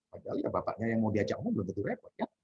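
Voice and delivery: normal talking voice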